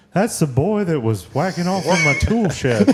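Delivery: cranky cartoon neighbor impression; Mister Anderson. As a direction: complain in a cartoon old-timer's voice. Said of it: "when I heard that voice".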